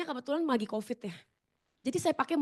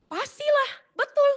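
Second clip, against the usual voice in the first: high, loud emphatic agreement; of course it did; emphatic yes; 13 semitones higher, 6 dB louder, slower than usual. Real, not acted. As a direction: insist it hit her hard. Admits she felt hurt.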